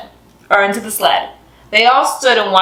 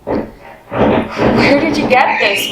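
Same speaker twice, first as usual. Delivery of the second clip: breathless; 3 semitones higher, much slower than usual. Acted. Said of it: "Welcome asked breathlessly".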